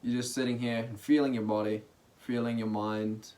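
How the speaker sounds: soft, relaxed meditation guiding with long pauses; guided meditation. Real, not acted. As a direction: ease listeners into stillness, quietly and unhurriedly.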